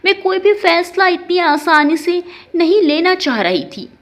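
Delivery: ordinary delivery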